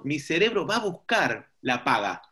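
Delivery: ordinary talk, nothing marked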